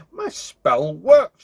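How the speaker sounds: loud, drawn-out witch's crowing; gleeful cackle. A narrator's reading. Witch hugging herself for joy, gleeful cackle. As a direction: crow it slowly, with lightly cackling glee. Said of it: "she cackled".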